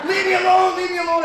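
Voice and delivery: high, very loud shout; replayed yelling. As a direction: yell it out the window. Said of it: "I've been yelling out the window".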